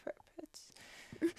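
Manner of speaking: barely voiced, faltering murmur; timid whisper. Passenger trying to catch the stewardess for pretzels, timid whisper. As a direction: trail off in a tiny, hesitant whisper.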